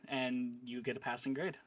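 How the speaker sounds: ordinary talk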